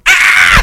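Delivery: very loud, piercing high shriek; imitated child's scream, sudden and shrill; terrified kid's scream. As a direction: shriek in mock terror at full volume. Kid screams on seeing him.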